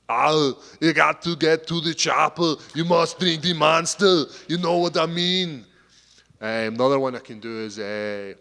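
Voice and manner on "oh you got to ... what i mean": loud, high Austrian action-hero impression; hammed-up movie-star imitation; Arnold Schwarzenegger. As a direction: belt it out like an Austrian strongman, dragging the endings. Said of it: "one of my favourites is Arnold Schwarzenegger".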